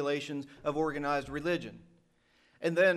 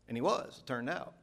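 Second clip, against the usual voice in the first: usual speaking voice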